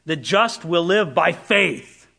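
slow, leaning hard on "faith"; "The just will live by faith" with "faith" stressed. Shown as a demonstration. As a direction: land the weight on "faith" at the end. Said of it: "Paul's emphasis is on faith".

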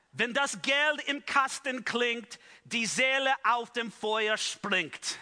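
German rhyme said slow and deliberate, "Wenn das Geld im Kasten klingt" in German; German slogan. Shown as a demonstration. Preacher quoting Tetzel's jingle to the congregation, slow German recitation. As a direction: recite the German couplet slowly like a salesman's jingle. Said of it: "sounds even cooler in German".

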